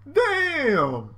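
loud, high, drawn-out exclamation; hyped-up amazed yell; awed outburst. Real, not acted. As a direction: react hugely to a killer move. Reader reacts to the strike.

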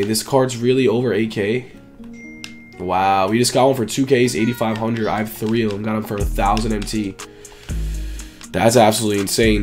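usual speaking voice

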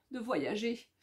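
ordinary delivery

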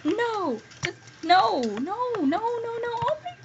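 high shocked exclamations; high disbelieving shock at the pig on the roof. Real, not acted. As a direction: react in horror to the blood, pitch up. Finds a pig and blood.

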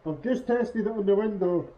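muffled behind a respirator mask; through a respirator mask. Real, not acted. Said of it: "hear me properly through the respirator".

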